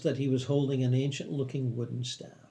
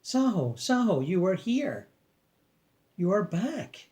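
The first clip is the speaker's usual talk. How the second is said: put-on higher, joyful cry in a Chinese accent; joyous Chinese accent; 6 semitones higher, slower than usual. A narrator's reading. Old man embracing a long-lost friend, joyous Chinese accent. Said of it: "exclaimed joyously in his thick Chinese accent".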